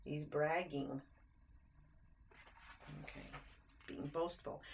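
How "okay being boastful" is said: usual speaking voice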